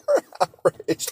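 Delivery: mock outrage squeaked out high through a laugh. Real, not acted. Speaker laughing at nations' hollow outrage, laughing. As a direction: laugh while parroting the empty outrage.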